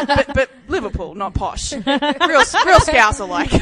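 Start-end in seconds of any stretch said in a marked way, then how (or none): 1.7-3.5 laughing hard, high and loud; cracking up as she says it; real giggling fit. Real, not acted. Laughing about Scouser accent.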